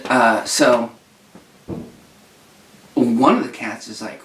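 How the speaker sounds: usual speaking voice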